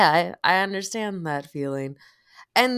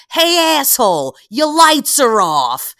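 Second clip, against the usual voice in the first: much higher, much louder mock street yell; New York driver yell; 12 semitones higher, 12 dB louder than usual. Retelling a rude helpful driver, loud mock yell. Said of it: "and he goes".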